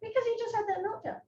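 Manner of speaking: a hint of a bossy adult; strict caregiver. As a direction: lay down the rule like a stiff staffer. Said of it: "And you're like".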